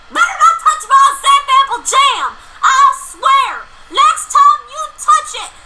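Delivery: high loud threatening rant; angry shouting. Acted. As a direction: yell a comic threat, laughing. Threatening shout over jam.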